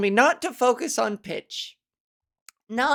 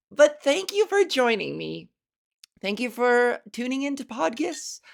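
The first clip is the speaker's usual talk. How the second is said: ordinary talk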